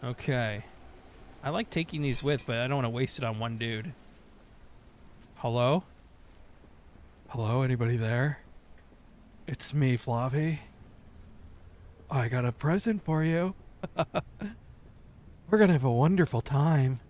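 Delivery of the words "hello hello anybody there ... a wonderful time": creepy taunting host. Acted.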